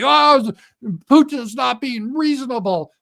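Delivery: high, loud, slow whiny pout; mock pout. Trump sulking that Putin won't deal, hammed-up whiny pout. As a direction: whine it like a sulking child. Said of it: "Trump is gonna pout and say".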